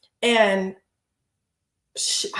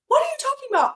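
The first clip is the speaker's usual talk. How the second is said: "What" jumps much higher, imitating her disbelief; a retold squeal of disbelief, pitched way up; incredulous repeat; 13 semitones higher, much faster than usual. To the guard accusing her, a high disbelieving mock cry. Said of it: "And I'm like".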